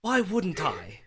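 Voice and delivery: high, loud indignant British dad; British father defending his accent. Acted. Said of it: "Daddy's British".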